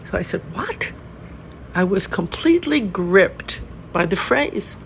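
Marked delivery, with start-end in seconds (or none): 0.5-0.9 a sudden high, hushed gasp of a question; high, soft startled exclamation; startled what. Retold or imitated. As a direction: caught off guard, breath pulled in. Startled on seeing the title.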